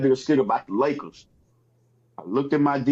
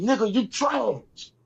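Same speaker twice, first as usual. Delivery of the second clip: high, slow taunt stretching "trash"; "trash" stretched out in a mock jeer; drawn-out TRASH; 9 semitones higher, much slower than usual. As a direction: hurl "trash" long and high. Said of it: "It's just how I say it".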